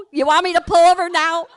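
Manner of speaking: can't believe he wants to stop here; loud, high, stunned now; shocked disbelief. Retold or imitated. Friend shocked at request to stop.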